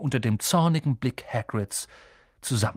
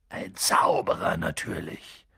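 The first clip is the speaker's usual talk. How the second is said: slow, low husky giant; gruff giant; 9 semitones lower than usual. A narrator's reading. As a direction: rumble it matter-of-factly.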